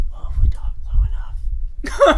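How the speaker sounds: slow imitated stage whisper; mock whisper. Imitating director fixing audio issues, hushed mock whisper. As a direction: whisper it as if it solves everything. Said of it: "He thought whispering would get rid".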